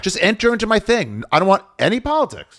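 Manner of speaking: high-pitched comic pleading; exasperated plea. Retold or imitated. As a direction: beg it high, fed up. Acts out refusing political videos.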